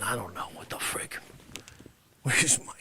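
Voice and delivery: unvoiced muttered whisper; whispered aside. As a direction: mutter it under your breath.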